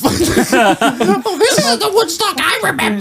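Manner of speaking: very high falsetto with a laugh; disappointed hippie lady. Retold or imitated. S0 voicing the hurt old hippie, laughing falsetto. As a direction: squeak it out, crestfallen and giggling. Hippie woman voiced in story.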